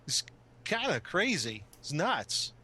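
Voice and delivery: halting delivery with odd upward leaps in pitch; Christopher Walken. Shown as a demonstration. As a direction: kinda crazy celebrity impression. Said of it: "you can do Christopher Walken".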